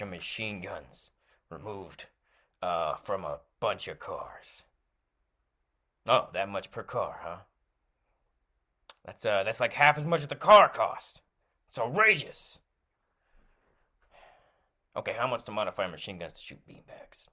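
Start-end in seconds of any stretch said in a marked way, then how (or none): none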